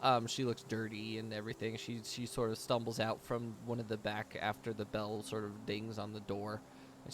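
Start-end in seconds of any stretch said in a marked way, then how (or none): none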